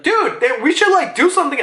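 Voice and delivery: high, hesitant mock urgency; high-pitched fretting; worried underling. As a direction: imitate an anxious subordinate. Worried marines urging action.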